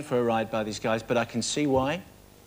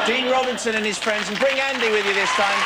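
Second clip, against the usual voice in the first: loud, raised announcer call over applause; higher, projected summons to the stage; calling guests down; 9 semitones higher, 5 dB louder than usual. Real, not acted. Host summoning Dean and friends from the audience, calling out.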